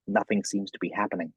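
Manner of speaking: ordinary talk, nothing marked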